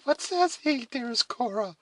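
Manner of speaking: put-on high, anxious girlish question; trembling voice. A narrator's reading. Alice asking her sister Cora, trembling voice. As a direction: ask fearfully, pitched very high. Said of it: "asked the trembling voice of Alice".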